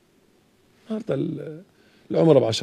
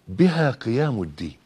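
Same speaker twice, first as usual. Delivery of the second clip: ordinary talk, nothing marked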